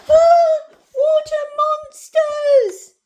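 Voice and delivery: very high, loud shriek; slow, hammed-up scream of alarm; yelping cry. A narrator's reading. Narrator voices sister frightened by her reflection, yelping cry. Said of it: "and one cried".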